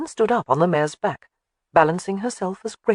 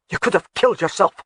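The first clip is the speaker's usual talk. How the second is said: through clenched teeth; 3 semitones higher than usual. A narrator's reading. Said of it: "he muttered between clenched teeth".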